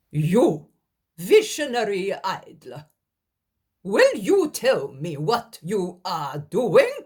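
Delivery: loud, slow furious demand; angry scolding. Acted. Helkai berating Daniel, loud and angry. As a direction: berate the idler.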